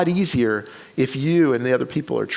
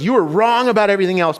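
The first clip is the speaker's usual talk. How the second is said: retold reproach, higher, louder and quick; emphatic confrontation; 4 semitones higher, 5 dB louder, faster than usual. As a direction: throw the accusation at him, as in a retelling. Acted confrontation in the story.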